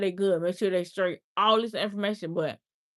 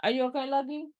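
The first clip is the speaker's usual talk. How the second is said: higher pet-talk voice; higher coo to the pet Yorkie; talking to the dog; 6 semitones higher, faster than usual. Real, not acted. Speaking to her dog.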